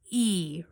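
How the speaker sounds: E held as a pure "ee"; long E sound. Shown as a demonstration. Teacher answering her own question on long E, E held as "ee". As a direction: say E alone as a long "ee". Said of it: "what would the long E sound sound like".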